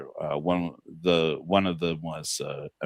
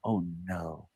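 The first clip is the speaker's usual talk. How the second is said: much softer, small "oh, no"; a hushed, pretend-worried reaction; mock dismay; 2 semitones lower, 11 dB quieter than usual. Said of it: "She's like".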